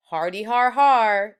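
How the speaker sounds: loud, mocking "hardy har har"; sarcastic fake laugh. Judy mocking a classmate's joke, loud sarcastic laugh. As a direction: scoff at the joke with a mock laugh.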